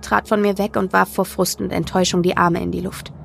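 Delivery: usual speaking voice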